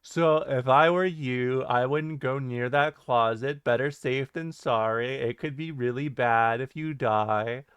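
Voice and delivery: measured, lilting list-narrator drone; mock video-narrator sign-off; Chills. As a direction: give deadpan cautionary advice like a countdown narrator. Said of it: "then you'll be like".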